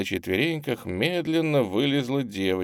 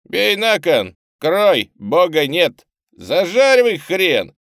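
loud, high gamblers' cries; excited shouts; 10 semitones higher, 9 dB louder, slower than usual. A narrator's reading. Street urchins shouting over their gambling, loud excited cries. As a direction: holler out over a card game. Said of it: "слышались возгласы из кучки".

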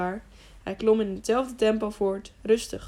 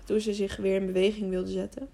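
usual speaking voice

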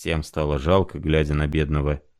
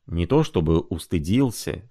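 usual speaking voice

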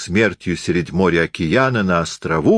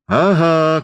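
triumphant cry; 7 semitones higher than usual. A narrator's reading. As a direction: crow it out in triumph. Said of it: "крикнул Наполеон". (торжествующий возглас)

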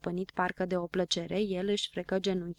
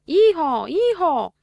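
loud, high hee-haw imitation; donkey bray; 9 semitones higher, 10 dB louder, much slower than usual. A narrator's reading. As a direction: bray like a clowning donkey impersonator. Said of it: "și începu să ragă". (răget de măgar, imitat tare)

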